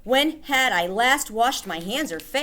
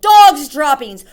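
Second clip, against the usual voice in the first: slow, loud, higher yell; witch's yell; 8 semitones higher, 16 dB louder, slower than usual. Acted. Witches yelling when they sniff out a child. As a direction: bellow it out across the room. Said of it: "the yell of".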